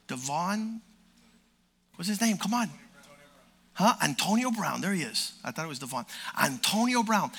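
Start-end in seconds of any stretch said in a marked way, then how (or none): none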